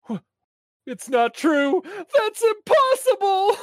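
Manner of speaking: high, rising cry of disbelief; shocked disbelief. Retold or imitated. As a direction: gasp it out like you can't believe it. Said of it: "I was like".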